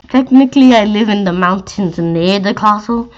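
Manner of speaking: plain reading voice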